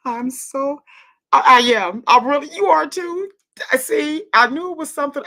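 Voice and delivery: loud, high burst of excitement; real thrilled outburst; excited realization. Real, not acted. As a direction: blurt it out high as the news lands. Learns listener is chosen too.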